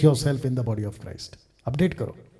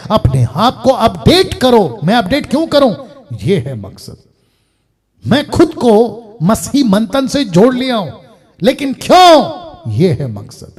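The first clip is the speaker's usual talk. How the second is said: preaching; 8 semitones higher, 10 dB louder than usual. Real, not acted. Preacher asking congregation why they are called, emphatic preaching. Rhetorical questions and low refrain.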